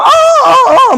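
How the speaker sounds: high, loud hammed-up pained screams; mock screams. Chunhyang being beaten, hammed-up screaming.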